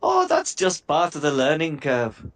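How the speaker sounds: put-on gnome line that slides into a light Indian accent; slipped into Indian. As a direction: let the Irish gnome slip into a mild Indian accent on "learning curve". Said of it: "I went Indian then".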